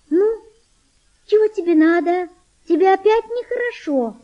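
put-on high, thin voice of a young girl; a girl gently asking a sick boy, high and tender. Girl asking the sick boy, high tender voice. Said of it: "сказала она тонким, нежным голосом".

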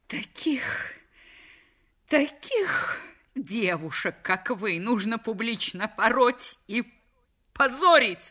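indignant exclamation. Acted. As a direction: sputter in outrage until the last word bursts out. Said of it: "воскликнула миссис Вейт". (негодующе, сквозь слёзы)

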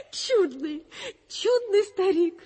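high, loud, laughing exclamation; tickled, drawn-out high praise; delighted amusement. Acted. Laughs delightedly at the old man.

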